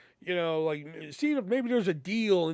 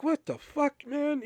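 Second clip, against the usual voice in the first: baffled outburst; 11 semitones higher, slower than usual. Retold or imitated. Baffled by hard math test.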